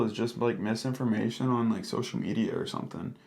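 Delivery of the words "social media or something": ordinary talk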